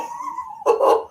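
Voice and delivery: high burst of laughter; delighted laugh. Real, not acted. Reacting to the spot.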